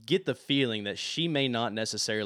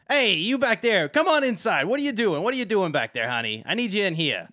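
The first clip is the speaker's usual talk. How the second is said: high, loud shout from across the room; screaming; 10 semitones higher, 10 dB louder than usual. Acted. Valette shouting for him to come inside. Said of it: "you hear Valette scream at you".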